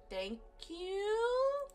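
rising, unsure thank you; baffled. Acted. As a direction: sound thrown by the compliment. Surprised by backhanded compliment.

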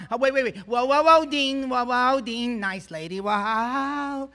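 high, loud goofy slapstick yelps; Jerry Lewis. Retold or imitated. Young comic to crazy Louis, high goofy Jerry Lewis yelps. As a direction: flail in a high, loud nutty-professor voice. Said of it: "if I do my Jerry Lewis".

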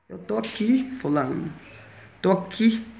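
resposta baixinha. Retold or imitated. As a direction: answer quietly, barely raising the voice. Responde baixinho ao irmão gritão. (respondendo baixinho, contida)

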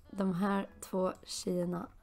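normal talking voice

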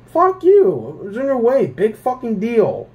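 angry rant. Retold or imitated. Ranting to viewers about being told to move, angry. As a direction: tell the rude stranger off.

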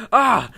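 sudden loud, high "ah"; cry of discovery. Real, not acted. Gamer spotting the thing he sought, loud cry of discovery. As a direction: burst out on finding it. Finds what he was seeking.

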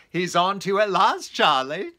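gleeful ribbing through a laugh; hearty laughing jab; laughing tease. Retold or imitated. Friend teasing Hawtrey.

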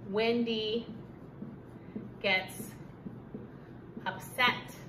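one word at a time, long pauses; slow dictation. Shown as a demonstration. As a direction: say each word as you write it down. Teacher dictates while writing.

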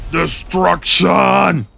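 slow loud hammed-up announcer call; announcer shout. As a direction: boom it out like an arena announcer. Announces enemy's defeat.

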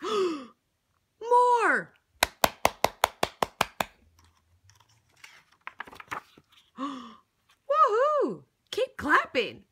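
gasp, then loud high cheers; excited cheering. Acted. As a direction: cheer them on wildly.

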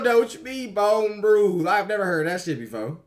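normal talking voice

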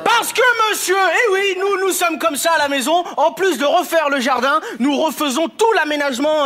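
high, mannered posh voice; affected homeowner. Acted. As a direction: play the prissy homeowner. Sketch, propriétaire maniéré. (voix aiguë et maniérée)

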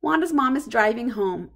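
ordinary delivery, nothing marked

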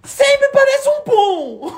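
squeaky, slow laughing words. Real, not acted. Laughing at the failed bubble.

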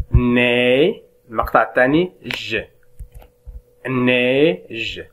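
slow syllable-by-syllable demo of "نيج"; syllables then word. Shown as a demonstration. Teacher sounding out syllables for pupils, slow and clear. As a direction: split "نيج" into "ني" and "ج", then blend "نيج" very slowly. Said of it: "إذن تتكون من".

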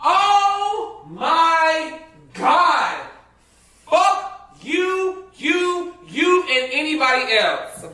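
high, drawn-out exasperation; fed-up, high-pitched cursing; exasperated outburst. Real, not acted. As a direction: stretch each word out in disgust. Exasperated cursing after the rant.

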